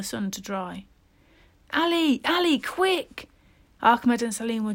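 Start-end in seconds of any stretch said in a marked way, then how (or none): 1.7-3.3 calling out. Acted. Boys calling from field edge.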